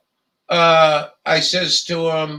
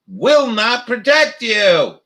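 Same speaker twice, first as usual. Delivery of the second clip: slow, higher mock-official reading; reading the box label; 4 semitones higher, 4 dB louder, slower than usual. Mask box warning read aloud for governors, slow and mocking.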